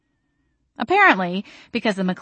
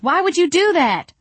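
loud, high-pitched protest; raised voice soaring in dismay; yelled; 11 semitones higher, 5 dB louder than usual. A narrator's reading. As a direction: yell it in appalled disbelief. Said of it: "I yelled".

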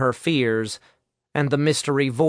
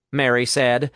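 plain reading voice

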